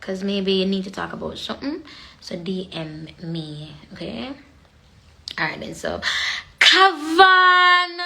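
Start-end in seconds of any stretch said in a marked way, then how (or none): 2.2-4.3 hushed whisper to the viewers. Real, not acted. Whispering a secret offer to DM her. 6.6-8.0 loud, high call of a name. Real, not acted. Excited shout greeting a viewer on her live.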